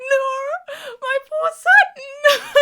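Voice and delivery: high, slow, squeaky mock sob of pity; pitying coo. Fan watching Sutton cry, high, squeaky pitying coo. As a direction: coo over her like a wounded pet. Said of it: "I was like".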